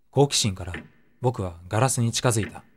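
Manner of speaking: ordinary delivery, nothing marked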